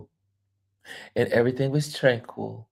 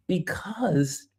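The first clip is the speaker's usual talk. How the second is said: hushed because; secretive whisper; whispered aside; 6 semitones higher, 4 dB quieter than usual. Real, not acted. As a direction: drop to a whisper.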